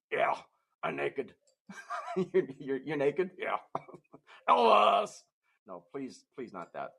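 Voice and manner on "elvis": high loud Elvis cry; Elvis shout. Acted. Dog puppet bursting out with his Elvis bit, shouted. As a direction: belt the name like an Elvis fan. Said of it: "impersonation of [name]".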